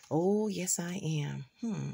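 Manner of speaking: unvoiced conspiratorial whisper. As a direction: whisper it like a secret. Secret vow about the Reverend.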